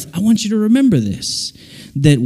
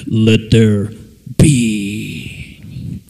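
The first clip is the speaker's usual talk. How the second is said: low, booming, drawn-out decree; God creating; 7 semitones lower, much slower than usual. Acted. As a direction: pronounce it as the Creator, deep and stretched. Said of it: "creative God who said".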